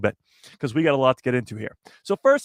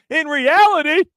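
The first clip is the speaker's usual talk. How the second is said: "reality" much higher and louder, held out; dramatic peak; 12 semitones higher, 6 dB louder than usual. Acted. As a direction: hit the peak of the reveal. Building to the big reveal.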